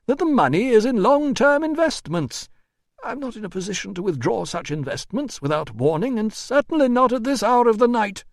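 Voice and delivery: high, loud, fussy voice; exasperated administrator. A narrator's reading. Administrator explaining to the doctor, high and exasperated. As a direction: protest in a high official voice.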